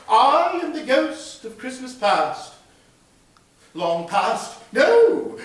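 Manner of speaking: lightly voiced ghost of Christmas past; a hint of the ghost's voice. Ghost speaks in scene.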